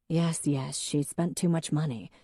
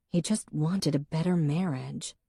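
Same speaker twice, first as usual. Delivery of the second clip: ordinary delivery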